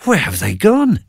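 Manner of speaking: high, startled question; amazed they vanished; bewildered. Acted. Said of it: "said Banquo to Macbeth".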